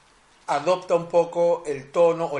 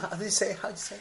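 spanglish; 3 semitones higher, 7 dB quieter than usual. Pretend. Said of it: "sigo hablando en Spanglish".

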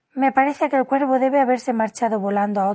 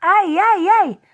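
loud, high-pitched yelps; shrieking queen, high and loud; howling in pain; 9 semitones higher, 6 dB louder, much slower than usual. A narrator's reading. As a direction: wail it out like a piercing alarm. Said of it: "empezó a dar unos alaridos tan fuertes".

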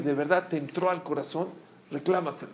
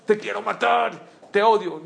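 hammed-up hateful outburst, raised voice; mock hatred; 4 semitones higher, 3 dB louder than usual. Rabbi acting out what people think hatred is, mock-furious. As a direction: play at loud, higher-pitched hatred. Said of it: "Odio no es nada más".

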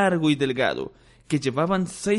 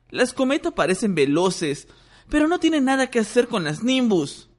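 put-on taunting boy; 9 semitones higher than usual. Ron taunting Malfoy.

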